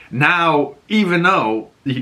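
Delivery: ordinary delivery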